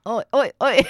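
high, loud hammed-up Cockney oi; mock Cockney oi. Co-host joins the bit with loud Cockney oi's. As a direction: shout the oi in a silly Cockney accent. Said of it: "ridiculous Cockney accent".

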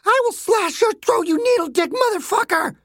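very high, furious screech; frenzied girl, pitched to the top; enraged threat. Acted. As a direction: lash out wildly at your captor. Captive girl threatens the doctor.

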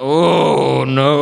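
hammed-up shocked groan; mock dismay. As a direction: react in playful horror. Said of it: "I'm like".

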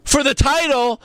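high announcer hype with a hole after "beat"; mock voiceover, dead gap where the name should be; edited recap announcer. Podcaster parodies recap with name cut out, high announcer. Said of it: "They just cut his name out".

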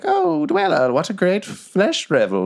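high-pitched gushing flatterer; fawning fiend. Acted. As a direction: gush in a high sycophant's voice. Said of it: "fiends and stuff coming up".

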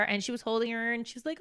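ordinary talk, nothing marked

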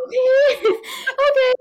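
high laughing okay. Real, not acted. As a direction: giggle through the words. Laughing after grandma story.

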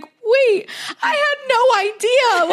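high, near-crying protest, slowed down; upset tearful plea. Retold or imitated. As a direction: squeak it out as if about to cry. Pleading after boyfriend starts fight.

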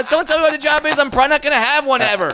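high-pitched, hammed-up improv kid; mock improv performer. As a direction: joke to the crowd in an over-keen host voice. Echoing the mock improv act.

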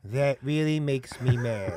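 slow cartoon hound voice; slow, deadpan cartoon character; Droopy Dog. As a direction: drag it out like a mournful cartoon dog. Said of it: "it's Droopy Dog".

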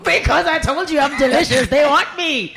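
high shout through laughter; loud joking boast. Real, not acted. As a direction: crow about how irresistible you are. Joking boast about mosquito.

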